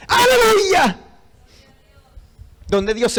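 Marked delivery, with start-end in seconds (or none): 0.1-1.0 high, ringing preacher's hallelujah; real burst of praise, pitched way up; exultant shout. Real, not acted.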